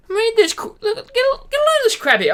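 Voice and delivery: high, loud and outraged; outraged outburst. Real, not acted. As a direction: yelp it out in disbelief. Outraged call to read.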